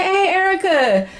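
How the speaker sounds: high-pitched imitated friendly greeting; retold happy recognition; delighted greeting. Retells old friend's surprised greeting.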